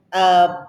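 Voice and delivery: 'About' drawn out loud with a wide OW; exaggerated Southern about. Husband saying it her way, exaggerated 'About'. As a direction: stretch the second syllable of 'About' into a long twangy OW. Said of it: "I'll say it your way".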